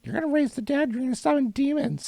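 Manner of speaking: high, mocking parent warning; hammed-up scaremongering parent; mock scary warning. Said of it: "So disappointed".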